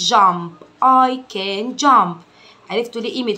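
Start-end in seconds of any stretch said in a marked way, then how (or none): 0.1-2.2 very slow, louder, every word apart; slow teaching read. Shown as a demonstration. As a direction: drill it slowly for a kid. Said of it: "امشي كلمة كلمة".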